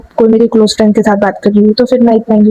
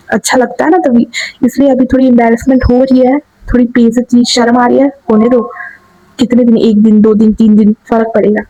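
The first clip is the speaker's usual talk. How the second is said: ordinary talk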